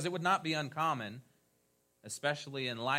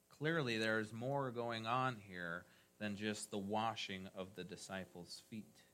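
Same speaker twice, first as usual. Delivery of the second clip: usual speaking voice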